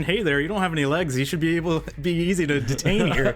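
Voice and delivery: crowd member. Acted. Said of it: "and then".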